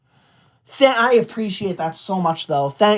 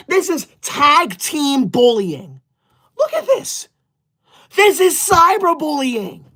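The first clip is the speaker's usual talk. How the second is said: mock whine; 5 semitones higher, slower than usual. Mock complaint about being bullied.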